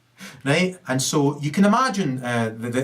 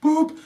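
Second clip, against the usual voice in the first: boop as one short squeak, much higher and a bit louder; demo boop; 3 dB louder than usual. Shown as a demonstration. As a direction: pop the boop out high and short. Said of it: "the right emphasis on the syllable I was looking for".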